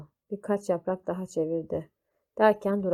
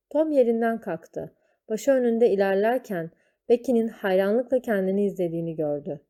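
usual speaking voice

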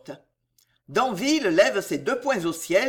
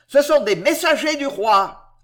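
louder, higher shouted warning; 5 semitones higher, 7 dB louder than usual. A narrator's reading. Sergeant shouting to the crowd, loud. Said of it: "hurle le sergent".

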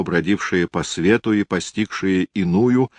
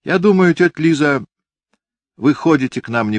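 put-on high, loud boy's voice; a defiant little boy speaking up; child voice; 10 semitones higher, 5 dB louder than usual. Boy tells aunt Liza, child voice.

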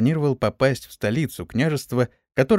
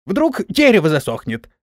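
high, loud hesitant pleading; excuse-making old master; 7 semitones higher, 5 dB louder than usual. A narrator's reading. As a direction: squeal out excuses in a raised voice. Woken master makes panicky excuses. (высоким голосом, оправдываясь)

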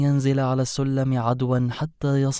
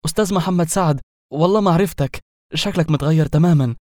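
higher, louder fawning welcome; excited respectful greeting; 5 semitones higher, 6 dB louder than usual. A narrator's reading. Director fawning over the actor he thinks he sees. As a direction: gush over a star you didn't recognize. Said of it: "ينحني في احترام".

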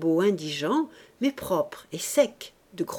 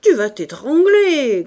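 loud, high, drawn-out scolding; scolding growl; 6 semitones higher, 13 dB louder, slower than usual. A narrator's reading. Ursus scolds the baby choking on milk, growling. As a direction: scold with a big swell on the last word. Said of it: "gronda Ursus".

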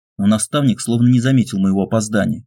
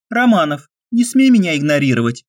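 higher, sharp female rebuke; indignant young woman; 7 semitones higher than usual. A narrator's reading. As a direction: call him out, indignant. Olga scolds the hero.

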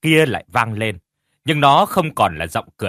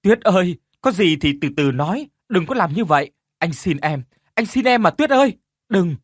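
loud high begging wail; pained wailing; 6 semitones higher, 4 dB louder than usual. Acted. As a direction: plead in pain at the door. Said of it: "miệng thì kêu gào lên trong sự đau đớn". (kêu gào đau đớn)

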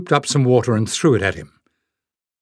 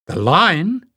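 higher, startled question; surprised cry; 6 semitones higher than usual. Acted. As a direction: catch on the word in alarm. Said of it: "cried Leif".

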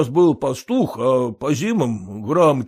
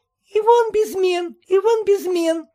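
put-on much higher childish sing-song mumble; teasing little boy; 5 dB louder than usual. A narrator's reading. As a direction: tease like a small boy. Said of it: "забормотал маленький сынишка".

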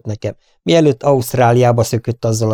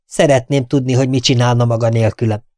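ordinary delivery, nothing marked

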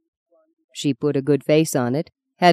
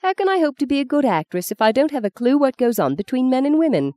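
put-on a touch higher, eager young woman; lightly voiced young woman; 8 semitones higher than usual. A narrator's reading. Young woman arguing with Dane, lightly voiced. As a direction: play Justine pleading her case.

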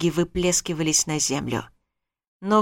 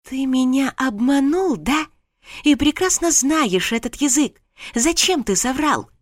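higher, accusing outburst; angry; 7 semitones higher than usual. Acted. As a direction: confront the liar hotly. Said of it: "горячая волна гнева".

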